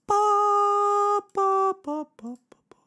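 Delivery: high held notes fading into short pops; sung po notes. Real, not acted. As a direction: sing a high, steady po that dies away. Vocalizes notes before starting game. (notas agudas cantadas en po, apagándose)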